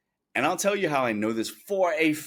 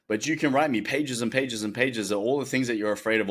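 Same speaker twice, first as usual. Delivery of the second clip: usual speaking voice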